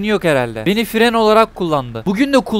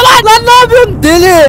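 very high, loud scream of disbelief; frantic, high-pitched shouting; outraged yell; 9 dB louder than usual. Real, not acted. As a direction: explode at a crazy driver. Outburst at a reckless driver.

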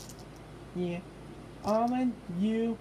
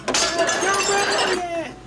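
loud, dragged-out warning, pitched up; slow loud warning; 6 semitones higher, 8 dB louder, much slower than usual. Acted. Toy warning the hungry one not to ruin it, slow loud threat. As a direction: stretch every word out as a threat.

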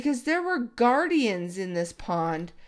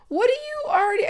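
sudden squeak, far higher; surprised; 5 dB louder, faster than usual. Real, not acted. Spotting the fox with an item, surprised squeak. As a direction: jump up in startled curiosity. Sees fox holding something.

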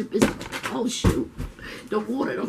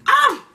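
sharp high yelp of pain; 6 dB louder than usual. Real, not acted. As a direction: cry out as the heat hits. Eating very spicy food.

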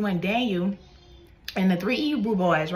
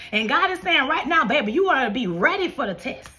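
high, animated exhortation; preacher's rising, insistent cadence; urgent preaching; 6 semitones higher than usual. Real, not acted. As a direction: urge the congregation on with fervor.

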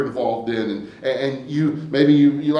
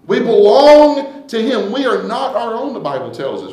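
ordinary talk, nothing marked

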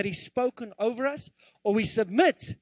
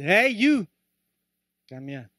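parrot calling out; 6 dB louder, slower than usual. Acted. Parrot hailing the returning customer, calling out. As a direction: hail someone as a cheeky parrot. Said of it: "And the parrot says".

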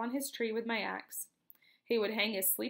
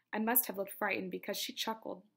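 plain reading voice

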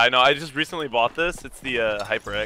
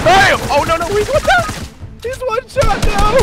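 very high, loud gamer scream; shrieking mid-fight alarm; frantic shouting; 7 dB louder than usual. Real, not acted. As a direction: scream the callout in a panic.

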